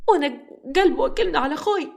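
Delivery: high, tearful wife scolding her husband; pleading through tears, pitched high; tearful protest. Acted. Wife crying at her husband over her brother, tearful. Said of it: "وهي بتبكي أكتر".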